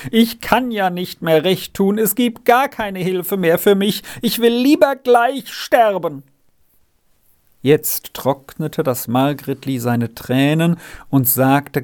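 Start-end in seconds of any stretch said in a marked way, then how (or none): 0.1-6.2 high-pitched despair, building to a cry; despairing groan. A narrator's reading. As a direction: moan out hopelessly, climbing higher. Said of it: "warf sich der Renz auf den Boden und stöhnte".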